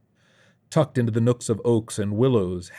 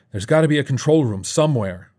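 raised, urgent voice with a touch of panting; 5 semitones higher, 5 dB louder than usual. A narrator's reading. Narrator answers Dave while running, slightly panting. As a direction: lightly act out talking on the run. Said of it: "I panted".

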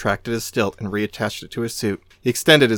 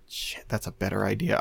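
whispered inner thought; 3 semitones lower, 10 dB quieter than usual. Retold or imitated.

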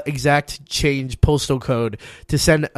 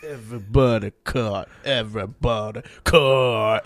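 mock movie voice; 5 semitones lower, slower than usual. Low slow movie-scene growl.

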